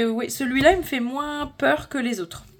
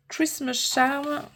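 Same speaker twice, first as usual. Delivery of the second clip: slow, drawn-out English accent on the book title; 4 semitones higher, much slower than usual. Shown as a demonstration. Vlogger showing off a coloring book title in an English accent. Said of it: "Vous voyez l'accent anglais quand même".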